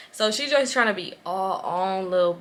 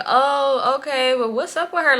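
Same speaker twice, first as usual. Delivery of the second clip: mock suspicious questioning; 6 semitones higher, 4 dB louder than usual. Imitated questioning on the phone.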